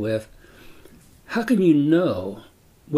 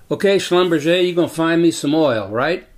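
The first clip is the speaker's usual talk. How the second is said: loud, higher demanding voice of the landowner; pushy landowner; 5 semitones higher, 5 dB louder than usual. Retold or imitated. Landowner badgering the surveyor for oil, loud and demanding. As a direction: press him for a promise like a pushy oilman.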